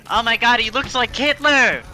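high, loud mocking voice; mocking imitation. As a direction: mimic a silly laughing kid. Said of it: "it's just the same shit where it's like".

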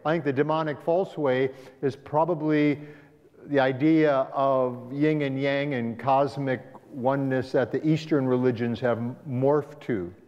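ordinary talk

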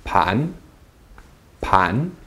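PAN rising from low; rising pitch on PAN; second tone. Shown as a demonstration. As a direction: lift PAN like a question.

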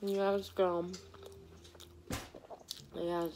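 talking while chewing food; words muffled by mouthful; mouth full, chewing. Real, not acted. Seen 0: bites taco at -7, cheeks full and chewing while speaking.

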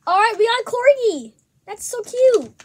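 high, loud gushing over something cute; excited delight. Real, not acted. Vlogger gets a corgi from an egg, excitedly gushing. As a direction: react with real high-pitched delight.

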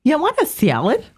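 imitating a Buffalo waitress; nasal Buffalo salad, rising; Buffalo accent. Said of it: "one time I was in Buffalo and they said".

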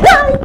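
sudden high scream of fright; startled scream. Real, not acted. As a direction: shriek as something grabs her foot. Sudden fright in haunted house.

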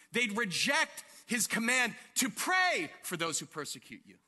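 ordinary talk

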